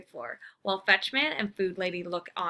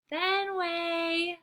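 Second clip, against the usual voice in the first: put-on girl calling again; 9 semitones higher than usual. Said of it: "she calls again".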